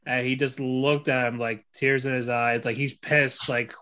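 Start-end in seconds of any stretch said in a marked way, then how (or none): none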